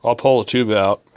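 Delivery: ordinary delivery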